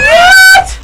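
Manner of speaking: shrill astonished what; high, loud mock disbelief; surprised. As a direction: gasp it out in shock. Pig says moo, surprise.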